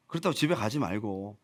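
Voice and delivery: normal talking voice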